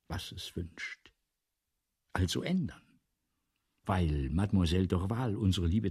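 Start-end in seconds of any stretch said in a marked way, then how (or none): 0.1-1.1 whisper. Acted. Writer mocks the clueless public.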